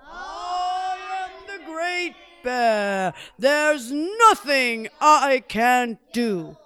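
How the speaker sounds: high, drawn-out bear boast; boastful Great Bear. Acted.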